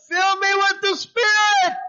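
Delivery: high, loud cry to God; spirited shout. Retold or imitated. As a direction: call it out up to the rafters. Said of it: "No, you didn't shout".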